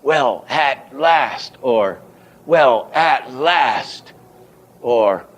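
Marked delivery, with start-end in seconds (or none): 0.0-1.6 "at" and "last" slow and separately stressed; two head accents. Shown as a demonstration. Teacher offering one reading, stress on "at" and "last". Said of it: "two head accents". 2.5-4.1 very slow, "at" and "last" each lifted high; two head accents. Shown as a demonstration. Teacher offering an alternative reading, slow stress on "at" and "last". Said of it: "two head accents".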